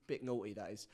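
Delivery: "naughty" said with the T swallowed; dropped T. Shown as a demonstration. Host showing the British way to say naughty, T dropped. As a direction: drop the T in "naughty", British style. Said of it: "you don't tend to say all the time the T".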